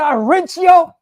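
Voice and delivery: high, loud threatening yell; imitated shout. Woman threatening agents, high imitated shout. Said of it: "She said".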